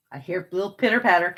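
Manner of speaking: ordinary talk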